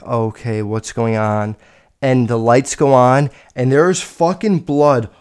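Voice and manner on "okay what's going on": low, soft confused question; quiet. Retold or imitated. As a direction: ask softly in confusion. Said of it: "And I was like".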